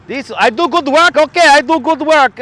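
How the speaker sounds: put-on a generic accent crossed with Russian; mock Russian accent. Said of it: "What accent is that".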